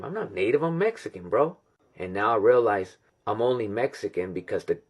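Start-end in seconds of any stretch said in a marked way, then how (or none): 0.0-1.6 retold protest climbing high; mock-incredulous correction; dismissive protest. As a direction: act out a baffled denial, pitch rising. Acts out his earlier thought.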